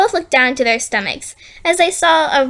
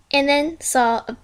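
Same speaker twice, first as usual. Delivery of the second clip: ordinary delivery, nothing marked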